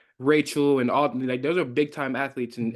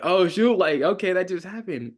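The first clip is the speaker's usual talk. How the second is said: higher and louder, caught off guard; mock surprise; 6 semitones higher, 4 dB louder than usual. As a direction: react like you can't believe the hit. Reacting to his own big hit.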